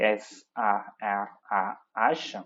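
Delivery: slow, letter by letter; each letter given separately; spelling out. Shown as a demonstration. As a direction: spell it out slowly for note-takers. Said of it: "she starts spelling out".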